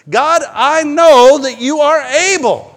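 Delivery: loud, high, slow cry of faith; fervent prayer. Shown as a demonstration. Preacher showing how to pray to God, fervent prayer. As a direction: call it up to God with full force. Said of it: "we need to pray more like that".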